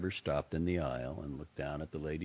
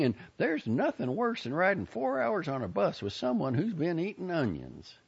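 high folksy lady with a loud opening; complaining Southern busybody; 4 dB louder than usual. Acted. Lady hints at onion breath on the bus, pointed.

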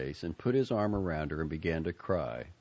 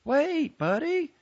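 high, loud call after him; 9 dB louder, slower than usual. A narrator's reading. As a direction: call him back as he runs. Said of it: "Joni called out behind him".

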